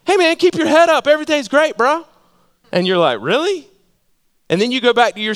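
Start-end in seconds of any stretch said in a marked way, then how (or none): 0.0-2.0 much higher, bright pep-talk voice; breezy encourager. Retold or imitated. Cheery friend offering empty encouragement. 3.2-3.7 skeptical really. Retold or imitated. Doubtful reply to pep talk.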